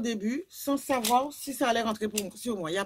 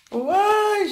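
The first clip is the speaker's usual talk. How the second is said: shriek; 11 semitones higher, 8 dB louder than usual. Real, not acted. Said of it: "je hurle".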